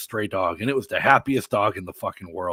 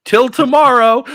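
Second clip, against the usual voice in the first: much higher, louder, slow teasing; cheeky quip; 12 semitones higher, 9 dB louder than usual. Retold or imitated. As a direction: tease her with a grin. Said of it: "I'm like".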